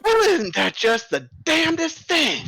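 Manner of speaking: a fast-talking circus boss buttering up the bears; ringleader voice. Acted. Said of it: "he just stops and he goes".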